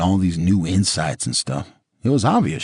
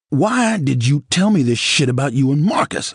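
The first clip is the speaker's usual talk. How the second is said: angry outburst; 9 semitones higher, 5 dB louder than usual. A narrator's reading. Unloading on her in the car.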